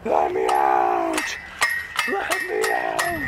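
deep, slow monster roar; trapped behind the gate, deep mock monster voice. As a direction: groan it out low and slow, like a caged beast.

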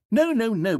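high, urgent no no no; correcting the player in a rush; alarmed correction. Acted. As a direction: catch the mistake quickly.

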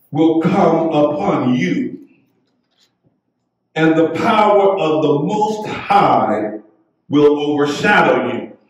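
ordinary talk, nothing marked

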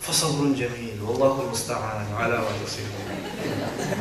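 patient father. Retold or imitated. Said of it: "Here's what your dad says".

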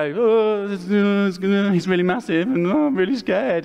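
the cowering Israelite army tells David they are scared; scared soldiers. Acted. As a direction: whimper it out like a frightened soldier. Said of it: "And they go".

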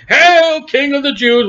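high, taunting salute; mocking hail. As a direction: hail him in scornful, exaggerated tribute. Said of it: "mocked him, saying".

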